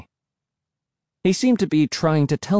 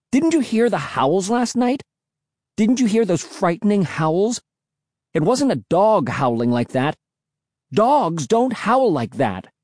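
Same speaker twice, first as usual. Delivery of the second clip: high, worked-up protest; losing control; 8 semitones higher than usual. A narrator's reading. Insisting about the howls, high and losing control. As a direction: plead the case in heated, raised pitch. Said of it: "feeling myself lose control".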